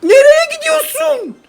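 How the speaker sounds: very high, slow, squeaky mock voice; mocking squeal. Real, not acted. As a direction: taunt the unit in a silly falsetto. Mocking the enemy card. (çok ince, alaycı ses)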